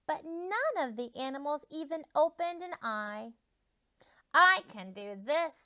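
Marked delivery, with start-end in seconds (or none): 4.4-5.6 louder, sure horse; confident horse. Acted. Horse announces he can wake everyone, louder. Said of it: "said the horse".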